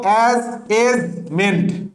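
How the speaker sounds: অ্যাসেসমেন্ট slowly split as ass-ess-ment; syllable-by-syllable pronunciation. Shown as a demonstration. Teacher showing how to read it, syllable-by-syllable pronunciation. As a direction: break অ্যাসেসমেন্ট into three slow, distinct parts. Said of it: "কিভাবে পড়বো?".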